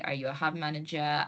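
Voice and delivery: normal talking voice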